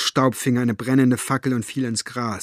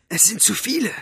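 urgent high-pitched hiss; 10 semitones higher than usual. A narrator's reading. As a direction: hiss a warning under pressure. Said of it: "zischte sie". (eindringlich und hoch gezischt)

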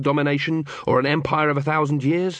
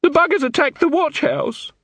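higher, frightened beaten guard; whimpering; 14 semitones higher than usual. A narrator's reading. A beaten guard fearfully reports the attack on the watch house, whimpering. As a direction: blurt it out scared and shaken. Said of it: "looked up at Detritus and whimpered".

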